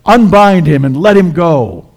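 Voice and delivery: high, ringing loud order; commanding shout. Acted. Jesus orders onlookers to free the risen man, commanding shout. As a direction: call it out as a full-voiced command.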